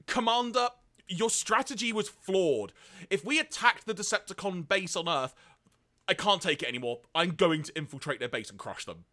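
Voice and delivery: high, loud indignant protest; hammed-up agitated soldier arguing with his commander; worked-up outburst. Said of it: "restrained and calmed down".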